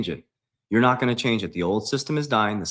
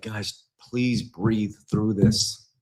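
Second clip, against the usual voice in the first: very slow, drawn-out plea; calming plea; much slower than usual. Real, not acted. Streamer urging worried followers not to panic, slow calming plea. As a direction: stretch each word out to steady them.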